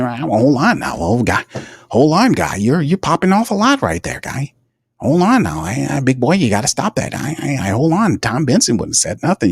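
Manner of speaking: high, put-on voice of a blustering team executive; Mickey Loomis impression. Streamer mocking the GM for his viewers in a high put-on voice. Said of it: "Mickey Loomis impressions".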